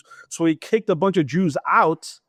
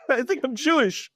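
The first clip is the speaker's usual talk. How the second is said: laughing; 9 semitones higher than usual. Retold or imitated. Repeats it to the rabbi, laughing.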